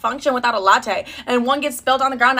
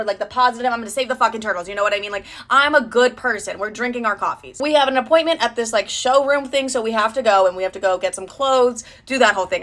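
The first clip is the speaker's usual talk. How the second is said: usual speaking voice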